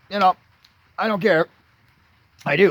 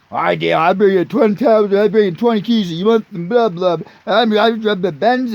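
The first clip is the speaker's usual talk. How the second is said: put-on bragging kid; 2 semitones higher, 4 dB louder than usual. Imitating bragging kid in jail.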